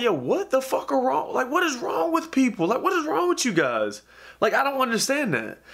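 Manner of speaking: high, exasperated what-is-wrong-with-you; retold disbelief. Speaker baffled at a guy mocking fat people, high disbelief. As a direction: pitch up in baffled outrage. Retells outrage at another guy.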